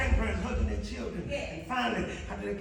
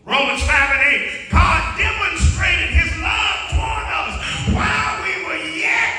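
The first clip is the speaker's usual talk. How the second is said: loud, rising sermon cadence; preaching; 11 dB louder than usual. Acted. Preacher to congregation, full-force preaching.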